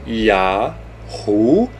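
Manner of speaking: "Yahuá" syllable by syllable, long pauses between; correct pronunciation. Shown as a demonstration. Teacher building the Name for viewers, "Yahuá" in separate syllables. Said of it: "Esta é a pronúncia do tetragrama".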